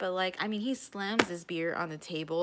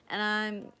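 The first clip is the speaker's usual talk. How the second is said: ordinary talk, nothing marked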